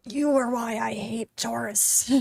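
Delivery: slow, deadpan grumble; annoyed inner thought. Retold or imitated. Thinking at the blocking tourists, slow and deadpan. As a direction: let the irritation sit, slowly. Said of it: "And I'm like".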